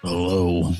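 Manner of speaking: low, creepy hello; a touch of spooky host. Acted. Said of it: "I'm gonna use my creepy voice".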